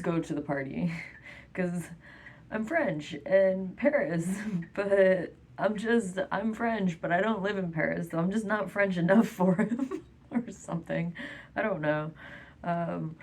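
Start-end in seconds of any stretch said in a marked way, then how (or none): none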